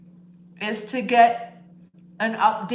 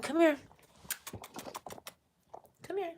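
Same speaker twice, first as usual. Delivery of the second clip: higher, soft repeated call to the cat; high pet call; 8 semitones higher, 8 dB quieter, faster than usual. Real, not acted. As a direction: lift each call up sweetly. Calling the cat.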